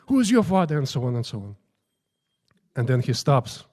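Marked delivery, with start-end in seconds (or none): 0.1-0.8 raised-pitch mock demand; comical shouting. Guru questioning every priest in turn, mock shouting. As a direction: imitate a guru's comic interrogation. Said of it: "he started shouting".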